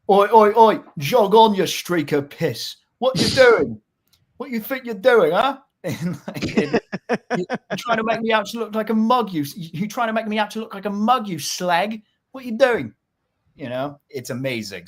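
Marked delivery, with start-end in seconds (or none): 0.1-5.6 loud, high-pitched Cockney hooligan banter; thick London accent. Acted. Football hooligans going back and forth, thick London accent. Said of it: "these really thick, deep London accents". 7.4-12.9 high-pitched, aggrieved Cockney hooligan; thick London accent. Acted. Hooligan accusing a rival during banter, thick London accent. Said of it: "these really thick, deep London accents".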